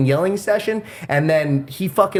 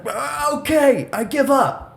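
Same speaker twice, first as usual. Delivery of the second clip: high, slow, timid give-up; meek surrender; 12 semitones higher, slower than usual. Retold or imitated. Neighbor pinned down by the dad, meek high surrender. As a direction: squeak it out like a beaten man. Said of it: "he's like".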